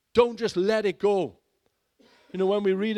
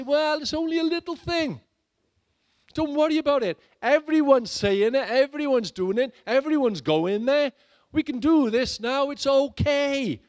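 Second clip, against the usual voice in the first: louder, higher, wheedling coax; coaxing tempter; 8 semitones higher, 4 dB louder than usual. Retold or imitated. Preacher mimicking tempters coaxing the congregation to compromise. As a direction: sweet-talk them into giving in.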